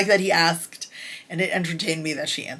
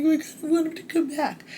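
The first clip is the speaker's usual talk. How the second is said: high put-on voice of the heroine's wish; high mock voice; 10 semitones higher, faster than usual. Reviewer voicing the heroine, high and coy. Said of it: "And she's like".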